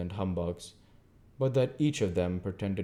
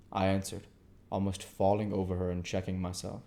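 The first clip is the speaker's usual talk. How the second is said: usual speaking voice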